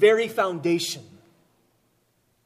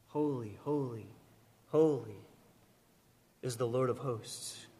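much softer, low, reverent murmur; hushed reverence; 5 semitones lower, 8 dB quieter than usual. Acted. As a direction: breathe it like a sacred secret. Hushed echo of the seraphim's song.

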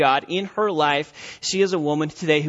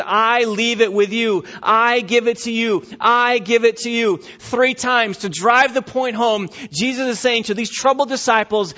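full-force pulpit preaching; 6 semitones higher, 5 dB louder than usual. Acted.

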